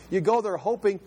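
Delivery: normal talking voice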